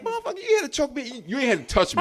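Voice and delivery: hammed-up angry woman talking back; the choked girlfriend yelling back at him, high; mock furious girlfriend. Woman's retort voiced.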